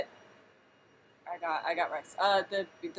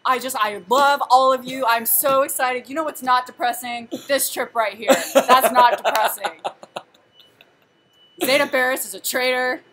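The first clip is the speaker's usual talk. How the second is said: loud, raised, over-excited intro voice; Cubs fan Han; 4 semitones higher, 10 dB louder than usual. Retold or imitated. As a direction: gush loudly, stretching the excited words. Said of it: "Do your best Cubs fan".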